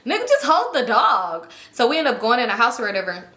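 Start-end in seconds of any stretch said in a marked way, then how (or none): none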